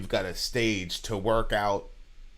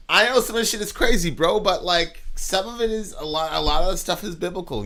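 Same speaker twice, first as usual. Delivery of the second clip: higher, louder, rushing retold explanation; worked-up retelling; excited explaining; 8 semitones higher, 5 dB louder than usual. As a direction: replay yourself eagerly explaining to a friend. Retelling his excited explanation in car.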